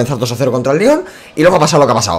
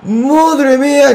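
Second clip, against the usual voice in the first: awed slow exclamation; 9 semitones higher, much slower than usual. Real, not acted. After goal.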